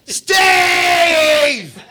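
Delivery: loud high shout broken by laughter; laughing retold yell; yelling again. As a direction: call Steve again, laughing. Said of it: "So she yells again".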